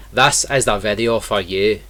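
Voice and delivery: general Scottish accent. Shown as a demonstration. Teacher showing viewers how to talk like this, Scottish accent. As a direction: put on a broad Scottish accent. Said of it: "if you want to talk like this".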